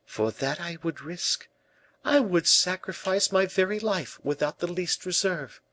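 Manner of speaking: high-pitched devoted declaration; noble-hearted girl. A narrator's reading. La Valliere would give her life, devotedly. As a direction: pledge it high and earnest. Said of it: "replied the noble-hearted girl".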